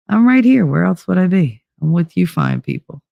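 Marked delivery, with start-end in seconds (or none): none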